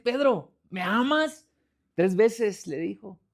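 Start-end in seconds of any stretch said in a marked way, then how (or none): 0.0-1.5 higher, insistent question; imitating Jesus asking with compassion; repeated question. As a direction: ask it once more, lifting the pitch. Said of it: "Y le vuelve a decir".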